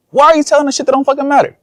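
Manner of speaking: high, rapid mock complaint; imitating impatient viewers. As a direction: rattle it off high as a fed-up heckler.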